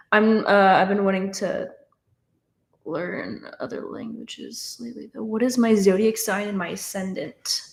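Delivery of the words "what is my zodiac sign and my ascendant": usual speaking voice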